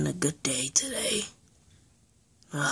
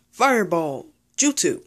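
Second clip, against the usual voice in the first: attack call; 3 semitones higher, 5 dB louder than usual. Acted. Suit Man calls out his fireball attack, loud battle cry. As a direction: shout the move name like an anime hero.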